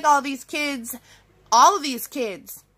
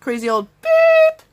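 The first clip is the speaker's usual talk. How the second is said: mocking teen sneer; 5 dB louder than usual. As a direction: jeer like a bratty teen. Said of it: "they're like".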